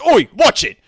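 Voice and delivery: loud, high indignant shout; acted angry passer-by; bumped-into stranger. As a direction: bark a warning at whoever bumped you. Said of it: "He looks up and goes".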